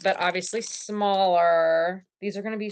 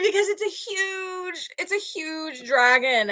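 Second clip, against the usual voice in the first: laughing; 8 semitones higher than usual. Real, not acted. Laughing about the huge dragon.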